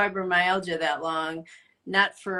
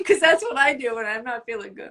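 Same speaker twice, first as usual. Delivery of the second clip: laughing while she admits it, faster; 6 semitones higher, 8 dB louder, faster than usual. Real, not acted. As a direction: giggle through the confession. Laughing at herself.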